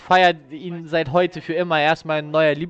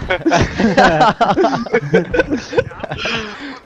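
overwhelmed laughter; 4 semitones higher, 4 dB louder than usual. Real, not acted. Overwhelmed by raid.

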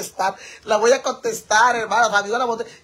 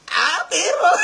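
high, loud mock-macho holler; 9 semitones higher, 4 dB louder, slower than usual. Streamer answering a comparison to a singer, laughing holler. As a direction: yell it out playfully, breaking into laughter. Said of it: "A ver, pareces [name]".

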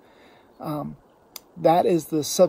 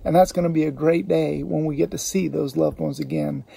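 ordinary talk

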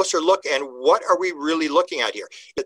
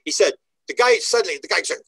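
ordinary talk, nothing marked

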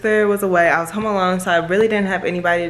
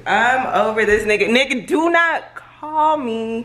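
fed-up, higher-pitched outburst; exasperated; 4 semitones higher, 4 dB louder, slower than usual. Real, not acted. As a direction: rise in pitch, drawing out the refusal. Fed up after unwanted phone call.

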